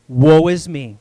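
loud despairing woe; anguished cry. Acted. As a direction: cry it from the gut. Isaiah's response, acted.

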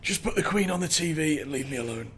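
stuffed Christmas-dinner grump. Acted. Podcaster plays T-Rex on Christmas afternoon, weary and stuffed. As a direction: beg feebly for peace after a huge meal. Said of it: "And now he's just like".

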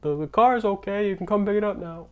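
high-pitched, hammed-up Italian accent; terrible Italian accent. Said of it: "that was a terrible Italian accent".

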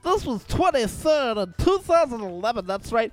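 mock radio DJ. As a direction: send up a cheesy radio DJ. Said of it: "even some of them guys are hokey".

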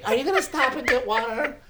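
lightly imitating wife's high questioning tone; high mock question. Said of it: "it's in the pitch".

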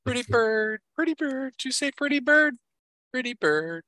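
very high squawking parrot. Pretend. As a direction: screech it like a pet parrot. Teasing co-host as a parrot.

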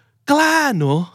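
high, drawn-out mock disbelief; acted incredulous exclamation; indignant disbelief. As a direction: stretch it high, as if scoffing at someone's nerve. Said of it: "อาจจะในใจหรือพูดออกมาเลยว่า".

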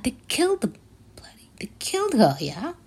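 whispered, appalled at the killing; shocked whisper. Real, not acted. Vlogger whispering to viewers about the killing, shocked whisper. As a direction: hiss it out in disbelief. Hushed outrage over the killing.

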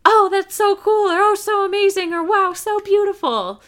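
loud, high-pitched mock gushing; imitated over-the-top excitement, way up high; gushing reaction. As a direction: gush over it with exaggerated amazement. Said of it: "I didn't have that reaction".

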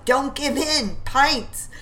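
rally the men in the melee; yelling. Acted. McClary in the thick of battle, high rallying yell.

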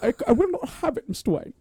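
high, hammed-up British protest; Michael Caine. Co-host dragging out the butler bit, high overwrought impression. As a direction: overdo the butler's emotional refusal. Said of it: "Michael Caine's a fun one to do".

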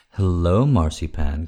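usual speaking voice

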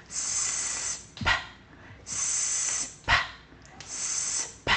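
long hiss then a popped p; hissing s, then a quick lip pop; alternating soft s and popped p; s p drill. Shown as a demonstration. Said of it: "Here I go".

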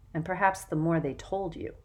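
ordinary delivery, nothing marked

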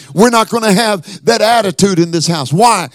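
high, forceful pulpit delivery; fervent revival preacher at the peak; full-force preaching. Real, not acted. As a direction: hammer it out to the congregation, building to a shout.

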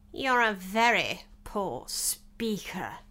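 slow, husky royal put-down; lightly voiced King, slow and weighty; scornful King.

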